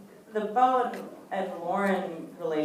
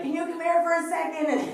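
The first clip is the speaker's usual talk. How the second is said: high-pitched mock yell for help; imitated yell; 12 semitones higher, 4 dB louder than usual. Costar yelling for an A D from his trailer, mock yell. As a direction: call out high, as if through a door. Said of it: "yelling for one of the A Ds".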